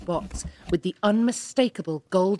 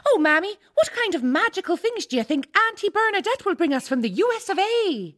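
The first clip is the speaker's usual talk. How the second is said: put-on high eager child voice; excited little girl; 11 semitones higher than usual. Young daughter asks Mammy about American gifts, high and eager. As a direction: ask it like a wide-eyed child.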